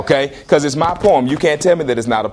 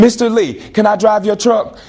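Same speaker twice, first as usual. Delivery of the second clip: higher-pitched student's request; lightly acted student voice, raised pitch; student asking; 6 semitones higher than usual. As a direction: ask like a pupil pleading with the teacher. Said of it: "And I say".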